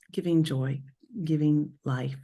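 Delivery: ordinary talk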